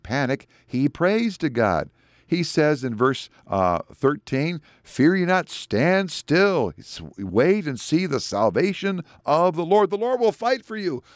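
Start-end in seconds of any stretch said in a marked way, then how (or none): 4.8-11.0 raised, rallying scripture proclamation; high-pitched, emphatic pulpit voice; preaching declamation. Retold or imitated. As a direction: preach it as Moses steadying the people. Preacher declaims Moses' words.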